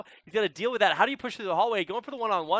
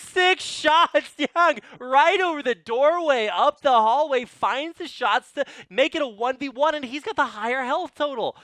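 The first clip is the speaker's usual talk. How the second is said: higher, louder hype call; excited play-by-play; 9 semitones higher, 8 dB louder than usual. Real, not acted. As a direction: shout the clutch play. Clutch moment called live.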